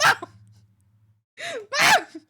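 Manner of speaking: very high, loud mock shriek; hammed-up screechy outburst; exasperated yelling. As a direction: holler at mom in high-pitched protest. Acted outburst at mom's text.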